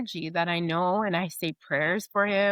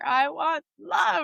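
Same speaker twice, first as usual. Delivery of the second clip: higher, slow, pleading cry for love; longing plea; 10 semitones higher, 3 dB louder, slower than usual. Retold or imitated. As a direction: stretch it out like a yearning plea.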